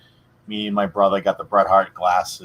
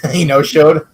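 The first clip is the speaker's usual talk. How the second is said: incredulous; 7 semitones higher, 8 dB louder than usual. Real, not acted.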